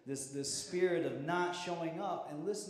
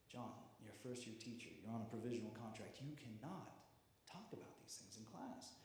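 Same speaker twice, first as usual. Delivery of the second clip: stern principal; 3 semitones lower, 11 dB quieter than usual. Retold or imitated. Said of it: "She's like".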